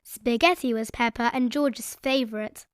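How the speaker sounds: plain reading voice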